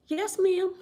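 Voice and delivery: high, small retold "yes ma'am" voice; meek, amused reply. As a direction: imitate an obedient answer, about to laugh. Retells meek reply to principal, amused.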